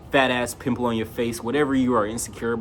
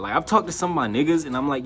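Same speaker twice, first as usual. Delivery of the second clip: ordinary talk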